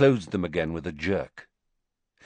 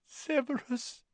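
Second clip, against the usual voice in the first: hushed, higher broken plea; whispered; 9 dB quieter than usual. Acted. Narcissa whispers to Snape through tears. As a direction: breathe his name through tears. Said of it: "she whispered".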